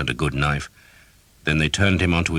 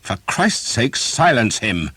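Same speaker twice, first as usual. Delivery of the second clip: higher, urgent angry snarl; 7 semitones higher, 4 dB louder, slower than usual. A narrator's reading. Knight snarling at his men to silence the archer. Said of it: "Sir Simon snarled".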